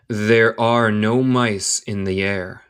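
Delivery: slow, measured, words joined together; slow connected reading. Shown as a demonstration. Teacher repeating the line for learners, slow and connected. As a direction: say the whole sentence slowly for learners.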